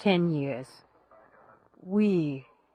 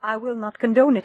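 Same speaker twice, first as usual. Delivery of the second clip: louder, raised and insistent; heated refusal; 4 semitones higher, 5 dB louder than usual. Acted. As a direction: dig in and defy him. Widow repeating refusal, more heated.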